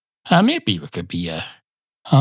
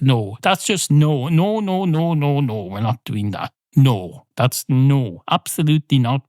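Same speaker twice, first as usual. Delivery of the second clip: usual speaking voice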